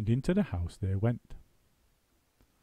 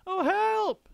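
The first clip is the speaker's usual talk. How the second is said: high shrill cry for help; screamed; 4 dB louder than usual. A narrator's reading. Missus Twit screaming in fright. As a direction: shriek for help. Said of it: "screamed Missus Twit".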